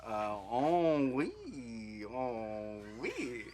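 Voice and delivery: slow, drawn-out mock French; French accent. Shown as a demonstration. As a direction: stretch each word in a put-on French accent. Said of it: "Let's hear your French accent".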